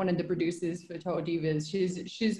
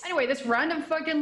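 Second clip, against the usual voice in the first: normal talking voice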